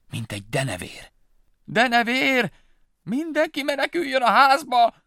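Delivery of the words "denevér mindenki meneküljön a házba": high, loud frightened mother mouse; panicked cry. Acted. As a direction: scream to herd the children inside. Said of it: "kiáltotta el magát Egér mama".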